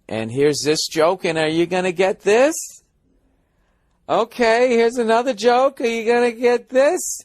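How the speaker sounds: mock tentative comic, pitch rising into a question; hammed-up anxious checking with the crowd; on his heels. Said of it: "I felt like I was a little on my heels".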